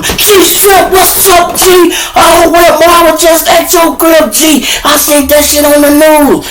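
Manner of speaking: high-pitched shouted greetings across a cell block; imitating a rowdy crowd, loud and shrieky high; rowdy inmates. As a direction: holler like excited jailbirds hailing a celebrity. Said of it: "It's so motherfucking rowdy as I come".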